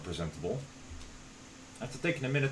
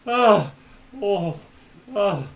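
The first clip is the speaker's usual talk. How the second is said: loud, high voiced pants; acted panting moans; out-of-breath groan; 7 semitones higher, 7 dB louder, much slower than usual. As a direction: groan each breath, winded from running. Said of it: "I'm voicing him being out of breath".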